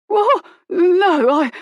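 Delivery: high, loud, breathless gasps; gasping panic. Acted. Thug in pain before Colin's pistol, gasping high and loud. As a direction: blurt it out in pained panic. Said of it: "he was gasping".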